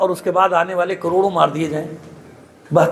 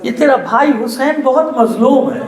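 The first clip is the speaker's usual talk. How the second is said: tearful pleading; 8 semitones higher than usual. Acted. Mother begging her daughter never to leave her brother, wailing. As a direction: voice climbing high into a wailing plea. Fatima's dying counsel to Zainab.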